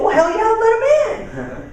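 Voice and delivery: very high, drawn-out hammed-up whoop; over-the-top enthusiastic character voice; gung-ho yell. Acted husband's eager reply in story.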